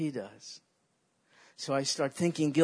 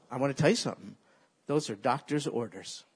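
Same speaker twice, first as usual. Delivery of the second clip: usual speaking voice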